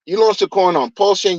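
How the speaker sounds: normal talking voice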